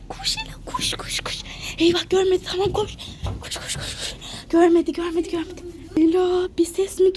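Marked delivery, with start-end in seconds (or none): 0.1-1.4 urgent whispered run; whispering while hiding. Pretend. Dashing to a new hiding spot. 3.4-4.1 rapid urgent whisper; whispering while hiding. Pretend.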